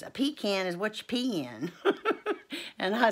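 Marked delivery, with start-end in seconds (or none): 0.0-1.7 raised pitch, punching "pecan" as PEE-can to match "pee in"; sister's PEE-can quip. Retold or imitated. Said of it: "My sister says".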